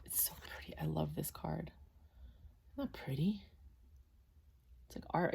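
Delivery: soft, breathless whisper of admiration; hushed, awed whisper over a pretty card. Real, not acted. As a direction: whisper it like a treasure in your hands. Admiring the tarot card art.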